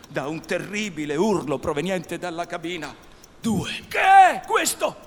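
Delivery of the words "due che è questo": loud, high startled yell; startled shout. Acted. Hook demands to know what screamed, startled shout. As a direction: shout it out, high, drawing out the question.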